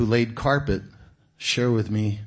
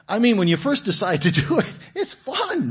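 mock excitement; 11 semitones higher, 3 dB louder than usual. Preacher recalls the first thrill of journaling, with high excited gushing. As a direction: pitch jumps way up on "fun".

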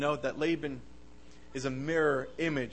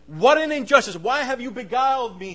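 loud, high indignant protest; outraged cry; 8 semitones higher, 10 dB louder than usual. Acted. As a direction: cry out at being wronged. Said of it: "he was outraged".

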